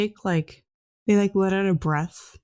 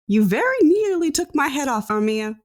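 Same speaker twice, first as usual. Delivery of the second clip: put-on higher and louder, outraged old man; indignant old scientist; 8 semitones higher, 4 dB louder than usual. Old scientist rebukes Armia for the wild spell, higher and outraged. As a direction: complain like a fussy professor nearly hit. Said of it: "The guy in the lab coat continues".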